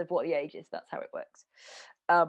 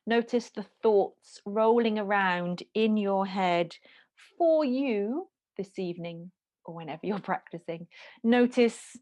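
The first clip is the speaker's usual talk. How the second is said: guided meditation. Acted. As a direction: guide them into stillness, slowly. Leads a meditation.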